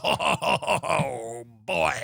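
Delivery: put-on low, doom-laden sigh of "oh boy"; low mock-ominous groan; grim "oh boy". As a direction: deliver it like dreadful news. Mock grim phone call opener.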